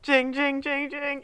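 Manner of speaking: high, bouncy "jing" like sleigh bells; jingle bell noise. Pretend. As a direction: chime it out like little bells. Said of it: "should we put some, like, jingle bell noises here?".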